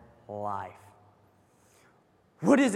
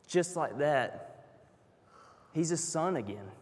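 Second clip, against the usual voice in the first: quiet, slowed tenderness; hushed sincere wonder; 3 semitones lower, 8 dB quieter than usual. Real, not acted. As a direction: let it land gently. Preacher softens the point.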